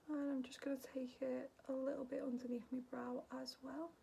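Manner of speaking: whispered makeup narration; ASMR tutorial whisper; soft whisper. Acted. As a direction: whisper the steps close to the mic.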